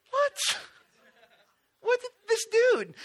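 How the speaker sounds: high, squeaky incredulous What; can't believe he's still asleep; disbelief. Retold or imitated. Incredulous that Jesus still sleeps.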